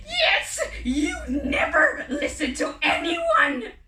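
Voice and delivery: loud, high, slow shriek; angry screeching green bird; shrieking cry. Acted. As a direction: cry out accusingly like a monster bird. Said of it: "cried one of the green birds".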